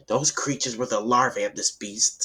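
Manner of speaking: ordinary delivery, nothing marked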